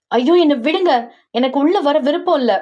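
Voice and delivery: high-pitched, acted shout to be let go; protesting cry. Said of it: "அவள் கத்த". (உயர்ந்த குரலில் கத்தி மறுப்பு)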